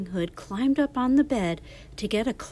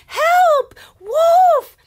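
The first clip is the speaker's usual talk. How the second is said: very high, very loud cry for help; loud shouting; 15 dB louder than usual. Acted. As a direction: scream it as loud as you can. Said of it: "shouting, "Help! Wolf!" as loudly as she could".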